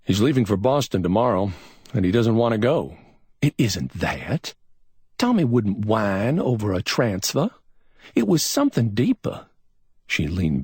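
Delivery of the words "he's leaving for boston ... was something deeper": lightly voiced young woman, raised higher; a hint of a worried young woman, pitched up; Billy's voice. A narrator's reading. Narrator voices Billy Dean.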